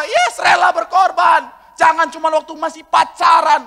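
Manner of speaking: loud, slow, emphatic preaching; preaching shout. Real, not acted. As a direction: drive the point home to the crowd. Preacher emphasizes at full force. (seruan berkhotbah lantang)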